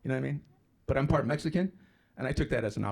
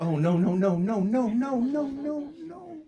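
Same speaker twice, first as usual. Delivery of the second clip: climbing higher with each no, fading at the top; hammed-up alarm rising to a squeak; mounting mock panic; 12 semitones higher, 4 dB louder, slower than usual. Watches him wobble on ladder.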